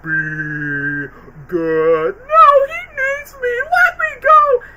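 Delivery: high, pleading squeal; Gingy's E.T. line. Retold or imitated. Quoting the cookie's E.T. farewell in a high tearful squeal. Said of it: "I did appreciate the".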